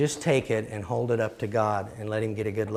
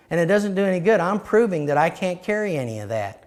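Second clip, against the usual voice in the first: higher and louder, pressing each line home; preaching at full force; 5 semitones higher, 3 dB louder than usual. Acted. Preacher to his congregation, rising to a forceful preaching shout. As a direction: preach it hard, building conviction.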